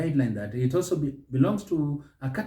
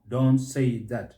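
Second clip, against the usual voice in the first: ordinary talk, nothing marked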